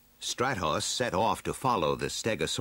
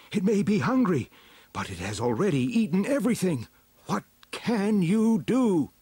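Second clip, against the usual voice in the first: high, louder pleading village chief; 12 semitones higher, 5 dB louder than usual. Acted. Village chief asks the heroes for help, high and urgent.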